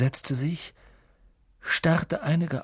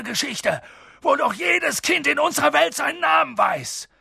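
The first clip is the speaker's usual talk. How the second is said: empört; 9 semitones higher than usual. A narrator's reading. Hagrid wütend über Skandal.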